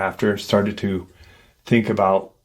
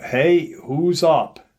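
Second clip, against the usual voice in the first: calling out, higher and drawn out; mild retold yell; 6 semitones higher, slower than usual. Camper calls from his tent to his friends, mild retold yell. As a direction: call it out slowly, a restrained yell. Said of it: "He yells out".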